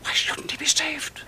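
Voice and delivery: slow, pressing whisper; urgent whisper. Acted. To Dunya, urging in a slow whisper. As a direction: coax her in a hush. Pleading bargain.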